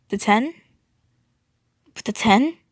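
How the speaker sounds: rattled questioning, rising sharply at the end; shocked, halting question with a lifted ending; shaken. A narrator's reading. Tenor echoing news that shook him, shaken. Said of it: "His voice was shaken".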